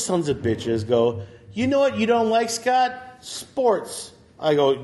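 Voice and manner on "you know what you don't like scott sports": lightly taunting teacher, pitched up; mock taunting teacher. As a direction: needle him like a gym teacher. Voicing gym teachers taunting him.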